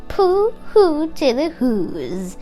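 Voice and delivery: ordinary delivery, nothing marked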